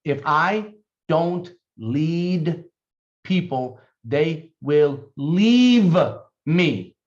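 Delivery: very slow, each word set apart; spaced-out dictation pace. Shown as a demonstration. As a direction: dictate it slowly so they can write. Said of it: "Please write this down".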